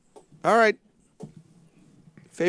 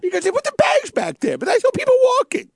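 high shrill showbiz shout; diva voice; 13 semitones higher than usual. Pretend. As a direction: squeal it like a diva. [name] bit continues.

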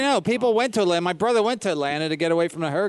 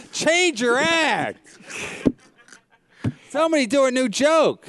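mock kid complaint; 8 semitones higher, slower than usual. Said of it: "The kid's probably like".

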